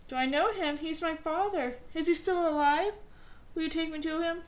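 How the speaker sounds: put-on high, excited boy asking about his father; eager puppet boy. A narrator's reading. Pinocchio asking the pigeon about his father, high and eager. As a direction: grab at the news that father lives.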